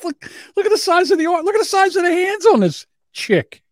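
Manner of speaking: high falsetto, hammed-up mockery. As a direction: squeak it out mockingly. Mocking comment on the clip.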